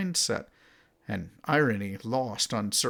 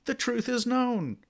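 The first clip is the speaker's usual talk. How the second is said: much higher, sneering quote of a thought controller; mocking censor; 10 semitones higher than usual. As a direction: put on a lofty censor voice lecturing heretics. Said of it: "the heretics are told".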